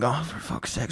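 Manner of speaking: very quiet, low aside; muttered retold dismay. Customer spotted by the man he's avoiding, muttered dismay. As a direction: mutter it under your breath.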